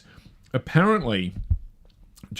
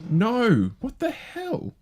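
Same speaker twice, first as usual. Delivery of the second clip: startled outburst; 13 semitones higher, much slower than usual. Real, not acted. Dogs suddenly bark on mic.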